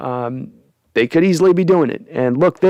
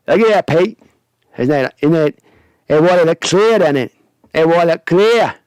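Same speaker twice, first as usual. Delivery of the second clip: hammed-up, badly faked accent as Megan; horrible put-on accent. Host plays Megan luring Pete to the water, horrible accent. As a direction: do a deliberately terrible accent, coaxing. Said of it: "I'm doing a horrible accent".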